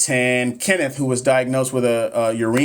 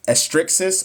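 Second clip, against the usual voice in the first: tentative, higher, rising question on estrixis; unsure pronunciation; 5 semitones higher, slower than usual. Real, not acted. Nurse trying a hard term for viewers, unsure. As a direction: sound out estrixis as a guess.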